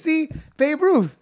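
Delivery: high, loud, excited vindication; triumphant insistence. Acted. Dog owner claims proof to bartender.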